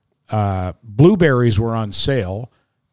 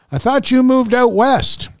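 higher, slower lady's voice; 12 semitones higher, slower than usual. Retold or imitated. Lady at checkout confusing him with Terry, higher lady's voice. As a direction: voice a puzzled older lady. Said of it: "And she said".

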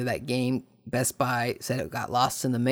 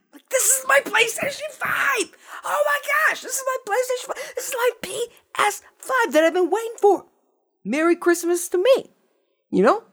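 giddy joy. Retold or imitated. Hugging the long-awaited console, very high giddy squeal. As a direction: shriek it like a kid on Christmas. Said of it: "I'm also very happy".

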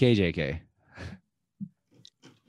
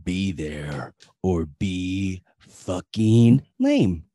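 Grim impression; 3 semitones lower, 3 dB louder, much slower than usual. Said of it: "my best Grim impression".

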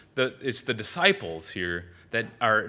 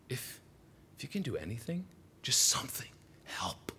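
hushed plea; 8 dB quieter than usual. Acted. Father's hushed doubtful plea.

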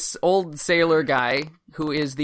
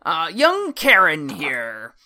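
put-on old sailor voice.

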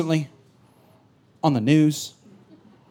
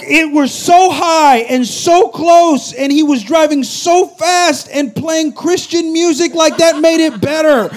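high, slow rattled voice; a touch of laughing panic; panicked complaint; 8 semitones higher, 5 dB louder, slower than usual. Retold or imitated. Retold panic on cliff drive.

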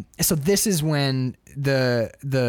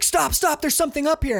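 high-pitched mock alarm; urgent warning; 12 semitones higher than usual. Expedition man up front warning of a barrier, urgent alarm. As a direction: call out a sudden warning, pitched way up.

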